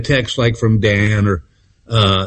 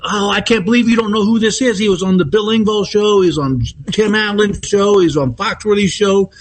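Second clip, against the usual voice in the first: gushing fan; 7 semitones higher than usual. Retold or imitated. Fan lady gushing at counter.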